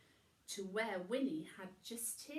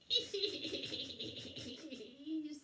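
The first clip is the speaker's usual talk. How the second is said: cat laugh; 6 semitones higher than usual. A narrator's reading. Wilbur laughs.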